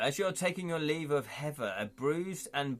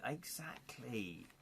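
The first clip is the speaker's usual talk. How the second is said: whisper; 11 dB quieter, slower than usual. Real, not acted. Whispered agreement.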